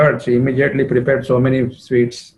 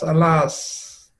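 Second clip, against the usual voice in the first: softer, drawn-out, despairing alas; fading lament; 3 semitones higher, 5 dB quieter than usual. Acted. Jatila's lament trails off, softer and despairing. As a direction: let the alas sink into a moan. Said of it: "She says".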